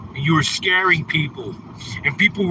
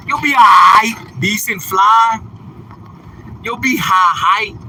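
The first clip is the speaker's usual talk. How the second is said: loud, high mock reassurance; hammed-up loud consolation; mock comfort; 4 semitones higher, 5 dB louder than usual. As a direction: comfort him with exaggerated volume.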